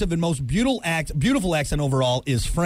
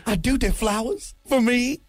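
put-on slow, high Southern belle accent; hammed-up Southern accent; 5 semitones higher than usual. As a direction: play a fluttery Southern belle. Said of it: "I'm just a Southern belle".

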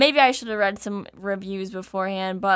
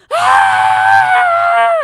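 very high, loud shriek of pain; shrill yelp at the sting; piercing scream; 10 dB louder than usual. Retold or imitated. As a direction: let out a sharp scream. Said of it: "I was like".

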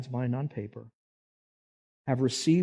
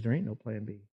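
quiet firm Jesus; 3 semitones lower, 10 dB quieter than usual. Acted. Jesus answers angel.

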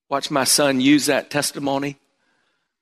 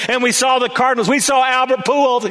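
bright, higher, coaxing lead-up; dad hyping up a fun memory; 9 semitones higher than usual. Retold or imitated. Dad listing fun places.

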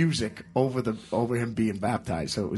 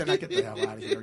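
rushed and laughing, much higher; laughing quip; faster than usual. Retold or imitated. Tony mocks the coven, fast laughing send-off. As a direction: blurt it through laughter. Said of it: "and he goes".

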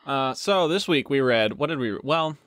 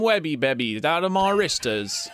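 higher, hammed-up British accent; mock British accent; 6 semitones higher than usual. Co-host parodies Sam's British Spider-Man, in a silly higher British accent. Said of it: "'cause British people can't".

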